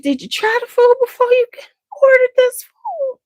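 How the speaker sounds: high, fast disbelieving question; mock-scold the company; incredulous. Scolding the wedding company, high incredulous question.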